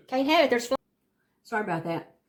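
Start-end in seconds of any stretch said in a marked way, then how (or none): 0.1-0.8 a sharp, loud burst while shooing the fly; sudden loud outburst. Real, not acted. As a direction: yell it out, startled by the fly. Chasing the fly off the food.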